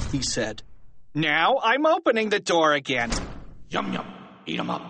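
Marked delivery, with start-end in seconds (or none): 1.1-3.1 taunting. A narrator's reading. Teacher taunts by reopening the door. 3.7-4.8 low, quick, quiet unvoiced growl; hushed, rough creature rasp; lurking monster. A narrator's reading. Monster heard lurking behind the door.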